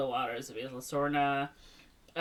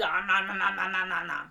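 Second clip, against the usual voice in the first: playful "nom nom" chomping noises; imitated munching. Shown as a demonstration. Showing cohost how the dinosaur ate him, imitated munching. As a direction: mimic a dinosaur gobbling someone up. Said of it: "He ate him like this".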